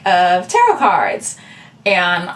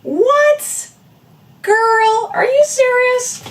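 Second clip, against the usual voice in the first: put-on much higher, a stunned "Girl, are you serious?"; shocked disbelief; much slower than usual. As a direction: react like a friend who can't believe what she just heard. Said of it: "And I was kinda like".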